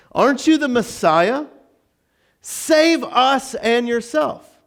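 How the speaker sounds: high-pitched challenge, drawn out at the end; challenging criminal. Acted. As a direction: goad him, then stretch out the demand. Acts the taunting criminal.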